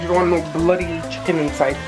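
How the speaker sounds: normal talking voice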